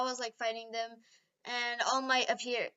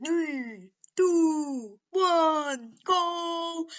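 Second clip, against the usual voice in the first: countdown shout; 5 semitones higher, 6 dB louder, much slower than usual. Acted. Race countdown called out.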